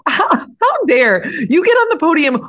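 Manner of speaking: high, loud outraged squeal; squeal volume. Real, not acted. Defending Ferrari to co-host in a squealing outraged voice. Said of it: "You've activated squeal volume".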